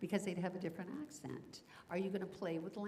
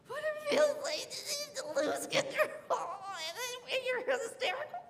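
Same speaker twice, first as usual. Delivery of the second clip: laughing; 6 dB louder than usual. Real, not acted. Laughing hysterically while explaining.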